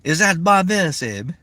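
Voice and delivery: slow, high-pitched call across a room; retold yell. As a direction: yell it out as an excited question. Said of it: "And they yelled out".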